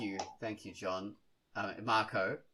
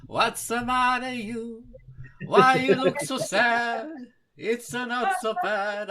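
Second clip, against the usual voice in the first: loud, higher hammed-up Italian accent; over-the-top Italian accent, much higher and louder; 7 semitones higher, 10 dB louder than usual. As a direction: play a cartoon Italian, loud and high. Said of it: "I can't do an Italian accent".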